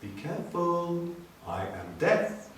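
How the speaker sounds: high then slow, soft sinister little voice; a tiny deadly snake warns. A narrator's reading. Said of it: "a tiny voice said".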